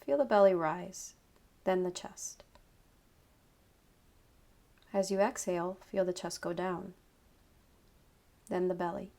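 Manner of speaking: usual speaking voice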